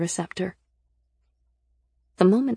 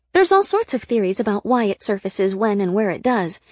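higher, lively explainer voice; pitched-up, eager guide; chatty informant character; 6 semitones higher, 6 dB louder than usual. Acted. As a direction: explain it brightly in a raised character voice. Voices Sybil explaining in dialogue.

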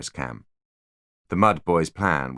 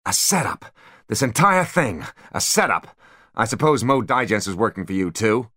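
raised, exasperated villain; angry outburst; 5 semitones higher than usual. A narrator's reading. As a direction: rant it out in frustration. Spyro's furious outburst.

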